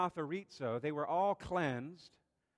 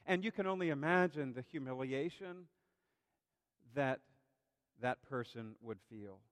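ordinary talk, nothing marked

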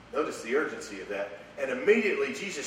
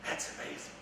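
whispered awe; 10 dB quieter than usual. Real, not acted. Awed aside during sermon.

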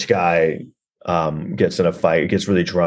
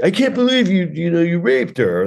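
high-pitched indignant accusation; mock outrage; 10 semitones higher than usual. Friend confronting the guy in the film, high mock outrage. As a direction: confront him in high, hammed-up disbelief. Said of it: "And he's like".

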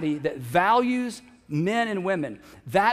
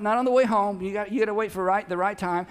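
ordinary talk

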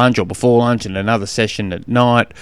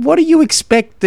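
high, incredulous mock exasperation; squeaky disbelieving aside in your head; exasperated thought; 3 dB louder than usual. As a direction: throw it up high like you can't believe him. Exasperated inner thought about buyer.

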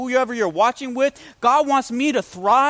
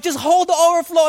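retold urgent command, raised pitch; mildly hammed-up panic; frantic order; 5 semitones higher, 4 dB louder than usual. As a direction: act out a flustered husband giving orders. Retells frantic order to wife.

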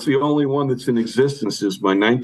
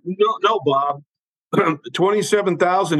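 insisting; 9 semitones higher than usual. Retold or imitated. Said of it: "And I went".

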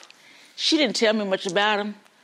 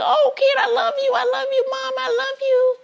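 high, loud gushing; hammed-up high cries of joy; overjoyed parents and kids; 14 semitones higher, 4 dB louder than usual. Family reunited after the rescue, overjoyed high gushing. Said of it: "imagine the happiness in that area".

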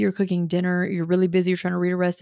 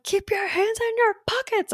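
high, strained mock order to the kids; a fed-up mother barking a rule, pitched way up; exasperated mom; 14 semitones higher than usual. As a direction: mom at the end of her rope at her boys. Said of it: "it'll drive me nuts. I'm like".

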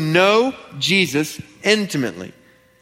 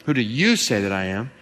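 emphatic "you", the rest of the question low; stress on YOU; 7 semitones lower, 4 dB quieter than usual. Shown as a demonstration. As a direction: hit the word "you". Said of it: "There's an emphasis on it".

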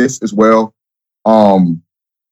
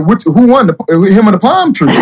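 teasing joke; 8 semitones higher than usual. Retold or imitated. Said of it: "I said".

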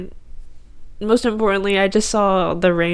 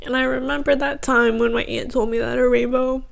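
real tearful voice, pitched higher; crying; 4 semitones higher, 3 dB quieter than usual. Real, not acted. Speaker recalling the rainbow as God's promise, crying. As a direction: fight back tears, voice thin with emotion. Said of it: "Stop crying".

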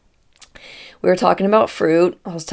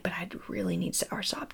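whisper; 15 dB quieter than usual. Retold or imitated. Begging for soursop.

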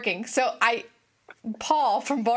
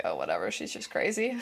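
low, quieter shrug of a voice; dismissive; 6 dB quieter than usual. Retold or imitated. Imagined caller dismissing her, low quiet shrug. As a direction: brush it off. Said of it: "he probably was just like".

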